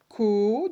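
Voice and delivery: "Co" loud, held on its own; isolated syllable. Shown as a demonstration. Teacher counting off syllables, loud and separate. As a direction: punch out "Co" and hold the vowel. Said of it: "deuxième syllabe".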